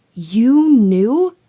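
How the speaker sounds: put-on high, loud, disbelieving boy; stunned boy. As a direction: gasp it out in disbelief.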